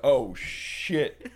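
loud, high startled oh shit; caught-off-guard outcry; panicked exclamation. Retold or imitated. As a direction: blurt it in sudden alarm. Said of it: "and I was like".

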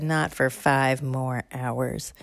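very slow, flat grandma; slow stern granny; slow stern reply. Acted. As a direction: drag out each word coldly.

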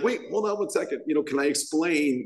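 high, pleading interruption; mock protest. Imitating his younger self cutting in to explain, mock pleading. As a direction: cut in to plead your case.

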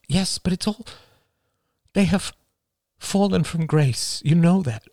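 mother fretting over family; worried mother. A narrator's reading. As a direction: plead gently as the worried mother. Mother voiced high.

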